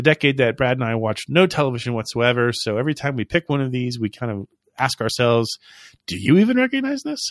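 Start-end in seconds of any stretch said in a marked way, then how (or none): 6.1-7.2 high mock question. Mock asking listeners about theme.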